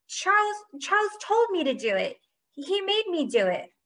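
very high, pleading whine; high-pitched tattling protest; whiny blame-shifting. A narrator's reading. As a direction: pin it on someone else, desperate. Caught cheater blames his teammate.

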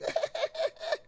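high, broken fake sobs; mock crying. Streamer replaying the crying Omegle stranger, high mock sobbing. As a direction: imitate shrill bawling in short bursts. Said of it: "Starts crying".

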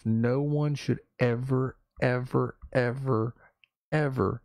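each "ever" hit hard and drawn out; stress on ever. Real, not acted. Talking to viewers, hammering each "ever". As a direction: lean on every "ever". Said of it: "repeats ever for emphasis".

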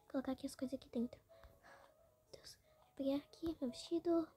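fast whisper while changing; rushed whispered aside; hurried whisper. Real, not acted. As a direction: whisper quickly, in a rush.

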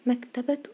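"مكتبة" as maktabatu, rising; taa when continuing. Shown as a demonstration. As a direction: sound the ة of "مكتبة" as "tu". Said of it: "نطقناها تاء".

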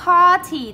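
party with PAR loud and high; PAR-ty, strong first syllable; stress on first syllable. Shown as a demonstration. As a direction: hit the PAR of party hard. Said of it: "đánh dấu trọng âm một".

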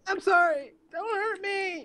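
very high, pleading put-on voice; mock-frightened falsetto plea; Craig. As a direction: beg like a scared, squeaky pretend friend. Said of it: "me pretending to be Craig".

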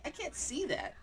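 high, squeamish refusal; high-pitched protest. Real, not acted. Recoils from the scary video.

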